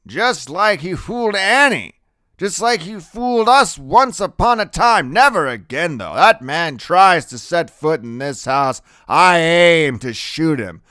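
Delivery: loud, high-pitched vow; irate, determined grandfather. A narrator's reading. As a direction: argue back hotly.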